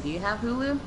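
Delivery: ordinary delivery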